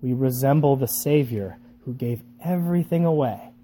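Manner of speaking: soft, hushed, earnest delivery; solemn preaching. Real, not acted. Preacher telling listeners what discipleship will cost, hushed and solemn. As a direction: bring it down to a quiet, weighty close.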